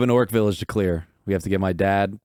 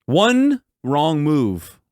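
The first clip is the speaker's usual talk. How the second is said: threatening; 4 semitones higher, 5 dB louder than usual. Acted. Player threatens kneeling ninjas.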